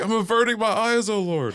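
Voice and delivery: high, hammed-up pleading; mock reverent cry to the heavens; pleading Arthur. Voicing cowering Arthur to God.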